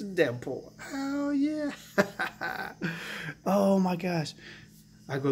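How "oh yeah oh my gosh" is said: giddy, can't hold in the excitement; thrilled. Real, not acted. Collector revealing a Deadpool first appearance, giddy and laughing. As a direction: squeal it high, then laugh with glee.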